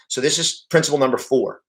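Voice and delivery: normal talking voice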